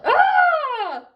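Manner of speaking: high loud scream; gorilla yell. Acted. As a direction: shriek in pain. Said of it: "the gorilla yelled".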